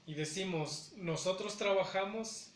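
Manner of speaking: ordinary delivery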